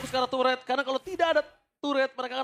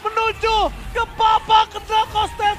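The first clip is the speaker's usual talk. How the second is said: fighting game announcer; 5 semitones higher, 5 dB louder than usual. Real, not acted. Caster calls the winning push.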